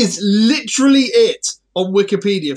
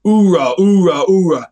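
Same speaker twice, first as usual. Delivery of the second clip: loud gung-ho soldier; 6 dB louder than usual. Retold or imitated.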